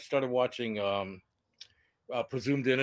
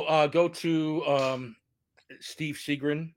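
ordinary talk, nothing marked

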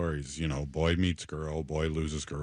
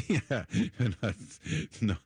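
laughing; 6 semitones higher than usual. Real, not acted. Laughs at her own joke.

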